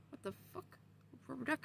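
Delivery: soft, puzzled whisper; hush, confused by the duck; baffled whisper. Retold or imitated.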